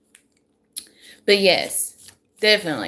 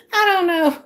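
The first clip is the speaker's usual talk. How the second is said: high; 11 semitones higher, 5 dB louder than usual. Real, not acted. Laughing repeat after whisper.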